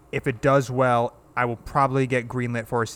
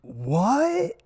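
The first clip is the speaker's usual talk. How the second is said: higher, drawn-out dazed stoner "what"; Keanu Reeves impression; 9 semitones higher than usual. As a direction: play a baffled stoner hero. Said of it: "this is my impersonation of Keanu Reeves".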